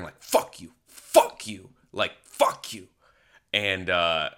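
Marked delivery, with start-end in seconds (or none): 0.2-2.9 mild mock mutter, cursing under breath; imitating a man cursing under his breath; muttered curses. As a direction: grumble the curses to himself. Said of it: "just came in muttering".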